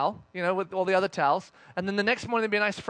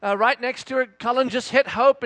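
ordinary talk

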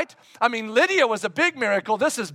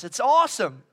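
ordinary talk, nothing marked

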